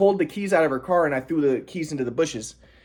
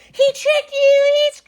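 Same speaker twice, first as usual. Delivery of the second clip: shrill-high mock scream, much higher and slower at the start; mock screaming mom; 3 dB louder, slower than usual. Mom screaming at the doctor, mocked high shriek. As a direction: imitate a mom shrieking accusations, hammed up. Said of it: "my mom was screaming".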